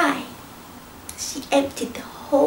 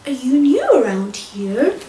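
excited dog voice; 3 semitones lower, 4 dB louder than usual. Acted. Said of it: "said Geoffrey excitedly".

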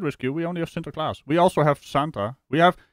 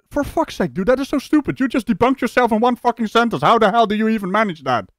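high-pitched incredulous rant; exasperated disbelief; 6 semitones higher than usual. Real, not acted. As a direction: berate them in exasperated disbelief. Incredulous at self-debunking claim.

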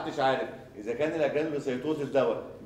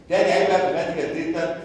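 emphatic lecturing; 5 semitones higher, 7 dB louder than usual. Real, not acted. Teacher highlighting next point.

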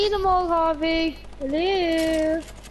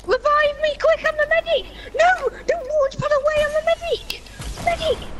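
high frantic squeals, begging for help; high desperate pleading; 9 semitones higher, 3 dB louder than usual. Real, not acted. Pleading for revive.

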